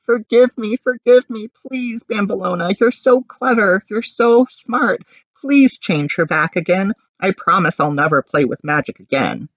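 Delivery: high, loud tearful begging; pleading. A narrator's reading. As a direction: beg desperately for forgiveness.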